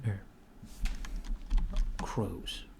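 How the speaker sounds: ordinary talk, nothing marked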